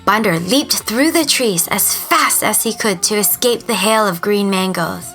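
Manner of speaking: usual speaking voice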